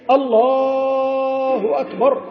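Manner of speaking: الله أكبر with the a of الله stretched very long, sung like a call; overlong Allah. Shown as a demonstration. Teacher demonstrating a wrong, stretched الله. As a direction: drag out الله far too long. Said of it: "المد هذا زيادة ليش".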